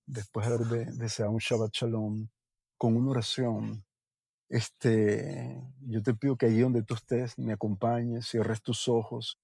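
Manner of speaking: low, soft prayer voice; hushed prayer. Acted. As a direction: pray quietly, inviting all to close their eyes. Leads a prayer.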